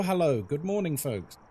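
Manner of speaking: normal talking voice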